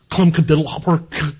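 a light imitation of an old clown's weird talk. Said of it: "he talked really weird".

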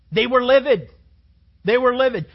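loud emphatic shout; loud, high preaching shout. Real, not acted. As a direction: hammer it out full voice. Preacher shouting about angry students.

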